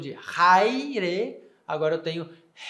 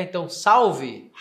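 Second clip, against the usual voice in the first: ordinary talk, nothing marked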